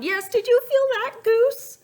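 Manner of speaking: high, loud, urgent; sensing the egg move; excited alarm. Acted. Egg about to hatch.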